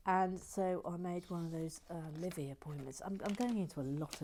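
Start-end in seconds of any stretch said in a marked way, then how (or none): none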